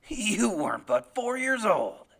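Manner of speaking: slow, loud, heavy denial; menacing father. Acted. Father dismisses his son's memory, slow forceful voice. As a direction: bear down on the boy slowly.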